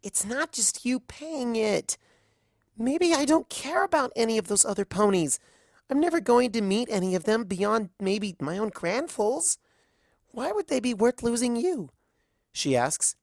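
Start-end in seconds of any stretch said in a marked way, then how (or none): 0.0-11.9 upset filly. Acted. Daughter protesting her father's sacrifice, hurt and high. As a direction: argue through hurt, pleading.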